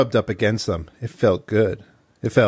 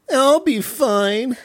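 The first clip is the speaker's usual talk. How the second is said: much higher whine, stress on wear; whiny high Thea voice; 11 semitones higher, slower than usual. Acted. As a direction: whine it like a sulky child. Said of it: "Thea assured her".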